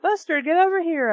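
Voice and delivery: calling the dog urgently; urgent plea; begging call. A narrator's reading. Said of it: "I begged".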